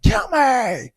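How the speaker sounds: high, loud imitated scream. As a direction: scream it like bloody murder. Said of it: "he was just screaming".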